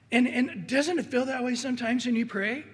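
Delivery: normal talking voice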